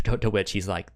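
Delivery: ordinary talk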